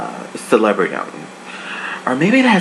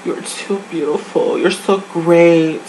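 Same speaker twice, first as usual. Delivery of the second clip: lightly gushing encouragement; 5 semitones higher than usual. Retold or imitated. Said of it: "she was like".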